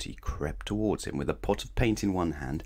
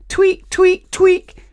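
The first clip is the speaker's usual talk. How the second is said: loud squeaky tweak noise; high squeaky tweak; 14 dB louder, much slower than usual. A narrator's reading. Sound effect.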